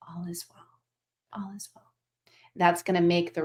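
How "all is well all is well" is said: soft, hushed, steady "all is well"; calm reassurance. Shown as a demonstration. Teacher showing the calm energy to bring. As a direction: breathe it out gently, like a settled presence. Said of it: "where your energy says".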